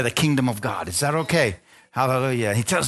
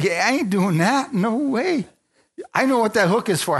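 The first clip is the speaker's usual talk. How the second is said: high refusal climbing higher; stubborn fish; 9 semitones higher than usual. Acted. The fish refuses the Lord's hook, rising high and indignant. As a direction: balk like a scared little fish. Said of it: "That fish is going".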